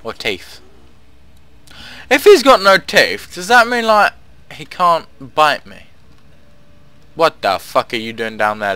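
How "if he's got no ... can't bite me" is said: louder, higher anxious rush; half-worried, half-joking jitter; nervous excitement. Real, not acted. Nervous about corpse biting.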